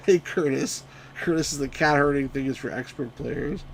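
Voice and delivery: ordinary talk, nothing marked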